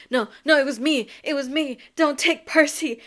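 loud, frantic protest; hammed-up desperate begging; panicked pleading. Acting out Grover pleading to be blamed.

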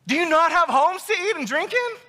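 high, incredulous rhetorical question; high-pitched Paul. Acted. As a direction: pitch it way up in exasperated disbelief. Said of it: "high-pitched and everything that I just did".

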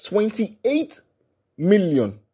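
high, loud disbelief at the number; exasperated outburst. Real, not acted. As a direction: blurt out the figure in disbelief. Shocked emphatic number.

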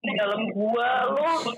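high, slow echoing cave call; spooky cave voice. Pretend. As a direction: play up the echo in a cave. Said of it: "Rekamnya di gua Jepang nih".

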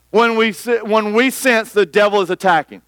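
ordinary talk, nothing marked

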